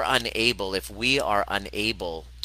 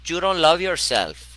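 put-on loud, higher-pitched Venezuelan-accented friend; 8 semitones higher, 5 dB louder than usual. Friend tells the speaker about self-love, in a Venezuelan accent. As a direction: imitate a friend's Venezuelan accent, high and emphatic. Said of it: "she's got this beautiful, uh, Venezuelan accent".

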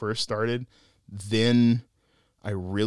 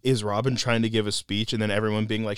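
usual speaking voice

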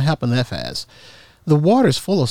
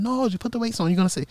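lightly voiced friend; 11 semitones higher, 6 dB quieter, much faster than usual. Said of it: "He said".

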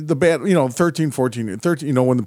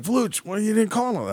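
higher-pitched mock protest; complaining kid; 10 semitones higher than usual. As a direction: imitate a young player griping at the referee. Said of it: "he looks at me, he's like".

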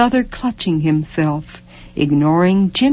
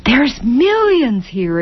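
exulting; 7 semitones higher, slower than usual. A narrator's reading. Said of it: "exulted Ben".

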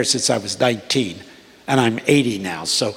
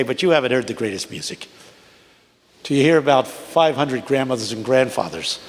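ordinary talk, nothing marked

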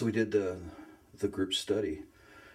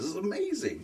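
laughing delight; 13 semitones higher than usual. Retold or imitated. Retold invite, excited gush.

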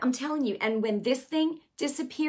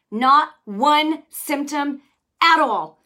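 louder and higher, slowly stressing not one; emphatic climax; 4 semitones higher, 10 dB louder, slower than usual. Real, not acted. Preacher to viewers, loud slow emphatic close. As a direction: drive home that nothing was left.

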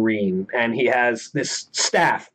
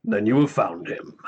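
ultra low rumble; 2 semitones lower than usual. Acted. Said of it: "the voice calls out".

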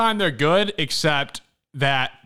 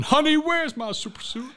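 imitated yell; 14 semitones higher than usual. Assistant principal yelling a movie line down the halls, mock yell. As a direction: holler it high like a movie catchphrase. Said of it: "go around yelling".

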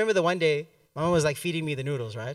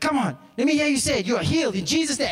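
loud, high, fired-up urging; hyped-up pastor; 6 semitones higher, 4 dB louder, faster than usual. Retold or imitated. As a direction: push him to declare it, louder and higher. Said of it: "he's going mental".

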